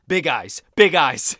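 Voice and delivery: loud director's call, big eyes; shouting at the kid actors to look amazed; yelling direction. Retold or imitated. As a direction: bark it out like a director on set. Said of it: "He would just yell".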